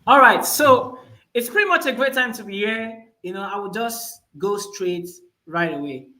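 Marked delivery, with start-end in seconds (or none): none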